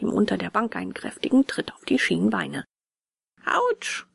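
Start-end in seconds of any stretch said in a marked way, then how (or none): 3.5-4.0 high yelp easing into complaint; acted schoolboy in pain; pained cry. A narrator's reading. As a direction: yelp at a kicked shin without shouting. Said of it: "schrie der Getroffene".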